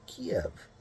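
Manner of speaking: Kiev said low and softly, "Kee-ev"; a low, quiet "Kiev" in two syllables; preferred older pronunciation. Shown as a demonstration. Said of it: "the way you really say it".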